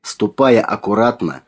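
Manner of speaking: plain reading voice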